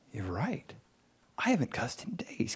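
a hushed, half-whispered you're right; hushed realization. Retold or imitated. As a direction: breathe it out like a secret dawning on you. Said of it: "I'm like".

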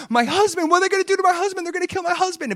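high-pitched frantic wife, freaking out; panicking. Retold or imitated. Wife panicking for her husband left behind, high frantic freak-out. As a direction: voice shoots way up in rushed panic. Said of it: "like, panicking. You know, she's freaking out".

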